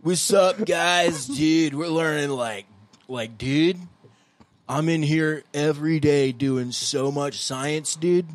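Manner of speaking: high, loud, slurred slow drawl; string every word into one word; drunk voice. Pretend. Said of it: "That's the drunk voice".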